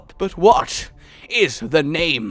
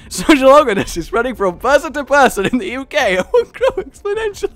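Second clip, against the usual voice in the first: higher, louder evil glee; gleeful villain; 9 semitones higher, 6 dB louder than usual. Pretend. Evil gamer gloats over his plague, high glee.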